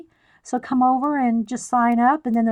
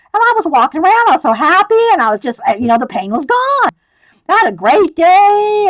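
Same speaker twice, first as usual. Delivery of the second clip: louder, higher, gleeful retelling; bursting with relief; mock joyful; 7 semitones higher, 8 dB louder than usual. Retells joyful pain-free day.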